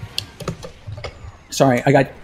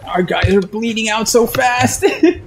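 higher, slow, half-laughing dismay; exasperated laughing; 6 semitones higher, slower than usual. Real, not acted. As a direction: laugh it off in disbelief. Exasperated at losing teammates.

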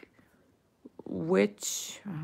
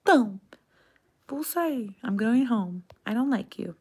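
higher, petulant mock exit line; mock huffy leaving; 6 semitones higher than usual. Angry relative announcing leaving, mock huff. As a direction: play the offended one storming off. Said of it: "bravo com alguém e eles falam".

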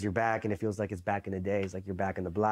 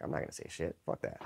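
whispered, much softer defiance; under-breath refusal; 6 semitones lower, 8 dB quieter than usual. Retold or imitated. Recruit quietly refusing to talk to drill instructor, whispered. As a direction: hiss it to yourself so nobody hears. Muttered private resolve.

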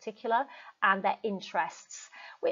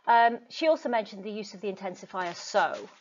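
normal talking voice

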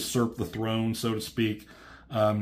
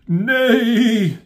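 weak chorus of nays; 11 semitones higher, 7 dB louder than usual. Retold or imitated. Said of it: "whoever were like".

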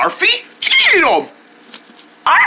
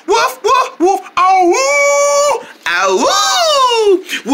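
howling like a dog; much slower than usual. Pretend. Howling in the song.

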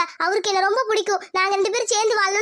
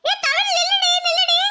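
higher, calling after her through tears; tearful call; 7 semitones higher than usual. Acted. Friend calls after the girl who runs off crying.

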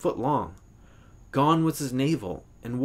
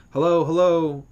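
loud, raised hello; calling out; 5 semitones higher, 6 dB louder than usual. A narrator's reading. Ned warns the Hallorans he is coming, calling loudly. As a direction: call out ahead to announce yourself. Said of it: "He called".

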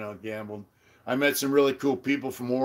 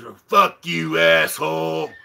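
imitated angry insult; 3 semitones higher, 4 dB louder than usual. Acting out neighbour's parting insult.

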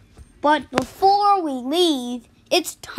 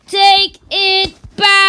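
higher, louder insisting; insisting loudly, building up; louder demand; 5 semitones higher, 6 dB louder than usual. Acted. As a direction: escalate the demand.

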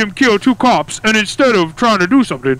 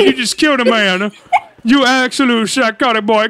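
usual speaking voice

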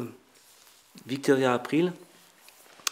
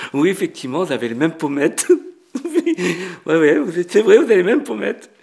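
loud, laughing re-enactment building higher; laughing agreement; 5 semitones higher, 8 dB louder than usual. Retold or imitated. Restaurateur agrees about the actress's cheekbones, laughing loudly. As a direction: agree heartily through bursts of laughter.

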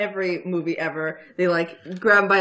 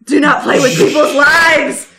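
loud, high, drawn-out cry; righteous battle shout; 6 semitones higher, 13 dB louder, slower than usual. Acted. Paladin lances the villain who toyed with lives, righteous shout. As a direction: bellow it as a slow, righteous rebuke.

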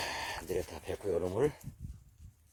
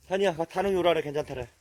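ordinary delivery

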